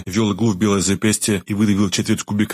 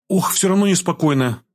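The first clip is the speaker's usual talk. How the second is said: put-on higher-pitched girl, sighing with unease; uneasy sigh; 9 semitones higher than usual. A narrator's reading. Girl frets to the healer before the job, uneasy sigh. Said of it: "вздохнула девушка".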